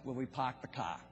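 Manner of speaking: lightly done Boston accent showing off the dropped Rs; a casual hint of Boston accent, park the car as pahk the cah. Shown as a demonstration. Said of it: "if you have not picked up on my accent".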